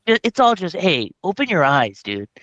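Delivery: mock outrage. Mock preaching friend's stance.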